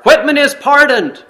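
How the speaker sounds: high, loud cry to stop them; shout. Acted. Miller calling out to the soldiers at the scaffold, loud high shout. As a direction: yell across the field to halt the hanging. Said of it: "shouted Miller".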